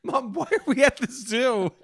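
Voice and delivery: put-on high, laughing little kid; child voice. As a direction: ask like a lost child.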